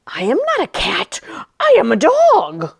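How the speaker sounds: lightly voiced indignant dog; a hint of a huffy dog insisting; dog character. Dog character Max answers narrator.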